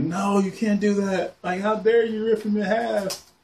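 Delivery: playful fake fury at the writers; mock outraged protest. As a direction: protest like a betrayed fan. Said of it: "I was just like".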